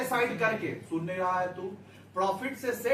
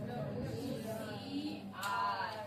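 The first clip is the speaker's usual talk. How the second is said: slow, soft abbreviation; 6 dB quieter, slower than usual. Shown as a demonstration. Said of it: "क्या बोलेंगे प्यार से?".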